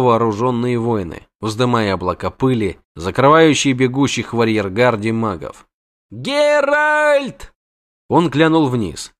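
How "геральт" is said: high, far-carrying call from below; desperate call to a friend. Acted. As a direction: shout his name across the gorge. Poet calling from below.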